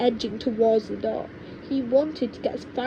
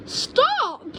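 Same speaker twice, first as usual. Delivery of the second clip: very high scared shout; frightened cry; 3 dB louder than usual. Acted. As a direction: cry out in fear. Frightened boy crying out.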